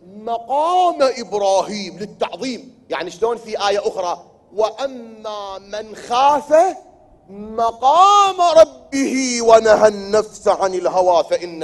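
high, loud preacher's declamation with drawn-out key words; impassioned preaching. Acted. Preacher proclaiming to the congregation the reverence due to Ibrahim's station, impassioned. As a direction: preach at full force, voice soaring on the sacred words.

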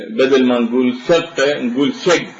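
شق said as shegg, ending on a hard g; dialect g for qaf. Shown as a demonstration. Lecturer contrasting standard and colloquial words, hard g. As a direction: give شق a hard g in place of the qaf. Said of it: "باللهجة العامية".

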